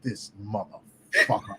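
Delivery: soft, slow mock menace; protective mama bear. Mama bear eyeing the man who beat her friend, acted menace. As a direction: say it slowly under your breath, acting affronted. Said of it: "I'm protected mama bear".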